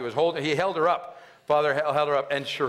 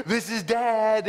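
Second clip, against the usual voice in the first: put-on slow, higher-pitched talk to the unborn baby; drawn-out greeting to a little one; imitating a father announcing himself to the belly; doting dad-to-be; 6 semitones higher, much slower than usual. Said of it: "It was very cute".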